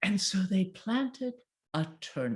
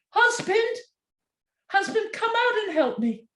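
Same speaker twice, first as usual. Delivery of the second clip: much higher calling voice; wife calls husband for help; 4 dB louder than usual. Acted. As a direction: call the husband from the garden. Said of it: "the wife went out".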